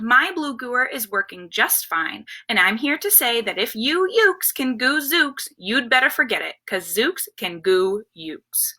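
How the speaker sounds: ordinary delivery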